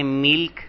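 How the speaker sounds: ملک said milk, short i after m, no vowel between l and k; pronounced milk. Shown as a demonstration. Teacher showing students one spelling read as milk. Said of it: "کبھی اس کو کہتے ہیں".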